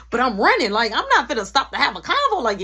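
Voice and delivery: normal talking voice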